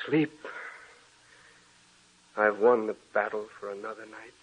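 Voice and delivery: hushed murmur of exhausted relief; spent and quiet. Acted. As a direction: let the fight drain out of you. Exhausted after the struggle.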